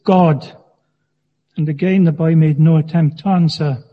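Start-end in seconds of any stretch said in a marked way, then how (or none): 0.0-0.6 slow, insistent repeat of the question; stern minister repeating. A narrator's reading. Minister pressing the silent boy, stern and slow. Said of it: "the minister repeated the question".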